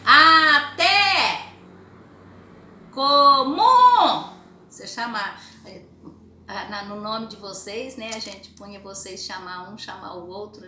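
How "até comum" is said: loud, high, drawn-out shout of each word; stretched calling yell, one word at a time. Shown as a demonstration. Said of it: "Gritar a palavra".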